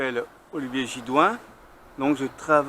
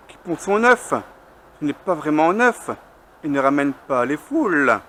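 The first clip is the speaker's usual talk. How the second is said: higher, louder measured verse reading; poem recitation; 5 semitones higher, 5 dB louder than usual. Acted. Poet reciting a hen poem at a slam, measured declamation. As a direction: recite it line by line, with pauses and lifted pitch.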